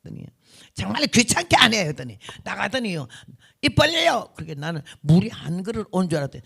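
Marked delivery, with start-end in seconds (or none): 0.7-1.8 imitated exasperated nurse, louder and higher; mock exasperation; acted annoyance. 3.6-4.3 imitated nurse's loud, high command; mock impatient command; brusque order. Acted nurse ordering patient.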